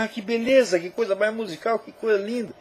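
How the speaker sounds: high-pitched mock admiration; admiring reader. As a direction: gush lightly, as a delighted reader would.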